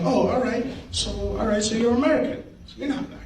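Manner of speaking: high-pitched retold questioning; acted puzzlement. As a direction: work it out aloud, mock confused. Retold puzzled exchange with classmate.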